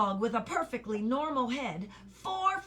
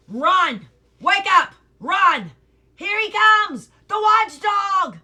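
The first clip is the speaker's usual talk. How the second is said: much higher, very loud frantic yelling; panicked shouts; 9 semitones higher, 14 dB louder, slower than usual. A narrator's reading. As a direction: scream a warning to everyone. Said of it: "Great shouts filled the air".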